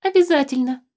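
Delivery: high, trembling voice. A narrator's reading. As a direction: let the voice quiver on the word. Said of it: "дрогнувшим голосом".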